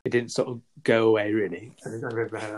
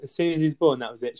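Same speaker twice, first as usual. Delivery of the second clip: ordinary talk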